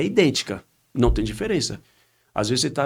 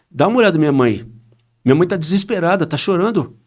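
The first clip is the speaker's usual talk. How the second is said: much louder, higher upset outburst; retold shout; 6 semitones higher, 9 dB louder than usual. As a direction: shout it at the pastor. Daughter shouts about her mother.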